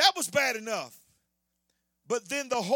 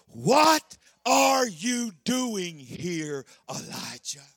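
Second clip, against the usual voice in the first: voice of God; 6 semitones lower, much slower than usual. Acted. Preacher voicing God's question.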